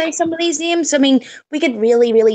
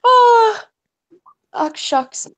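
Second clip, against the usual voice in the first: mock bashful; 9 semitones higher, much slower than usual.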